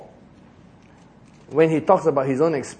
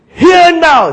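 angry Moses; 11 semitones higher, 11 dB louder than usual. Acted. Moses angrily addressing the people.

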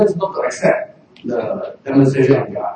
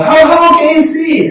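high, loud disbelieving question; incredulous demand; 12 semitones higher, 5 dB louder than usual. Retold or imitated. Pharisees grilling the parents, high incredulous demand.

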